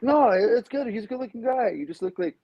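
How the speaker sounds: imitated high, placating voice; high-pitched backtracking. People softening a backhanded compliment, high mimicking voice. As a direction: pitch it way up, mock reassurance.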